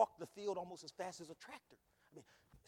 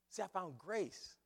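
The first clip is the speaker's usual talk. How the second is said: ordinary talk, nothing marked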